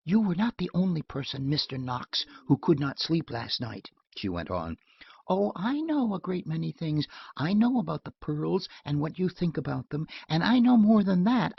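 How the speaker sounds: a hint of a wounded girl accusing; young woman, lightly voiced, close to tears; young woman upset. Marjorie voiced in story.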